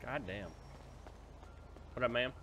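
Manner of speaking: normal talking voice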